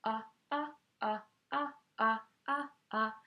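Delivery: short ah ah on and off; light switch ah. Shown as a demonstration. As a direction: pop each ah like a switch. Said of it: "it sounds like ah".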